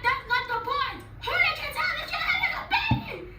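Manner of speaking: put-on confronting boyfriend over the baby shower, exasperated; exasperated shout. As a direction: yell it at him in disbelief. Angry retold confrontation with boyfriend.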